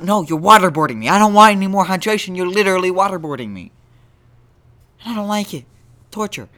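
high, loud, hammed-up whine of protest; mock outraged protest. Podcaster protesting to his own voice, in a high loud mock-outraged whine. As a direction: squeal it like you're being tortured.

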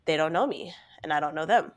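normal talking voice

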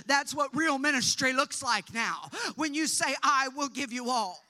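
loud, high, driving preacher's call; preaching at full force. Acted. Preacher urging the church toward real ministry, full force. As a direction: build it up and proclaim it to the congregation.

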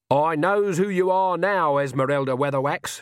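defiant. Acted. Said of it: "she said".

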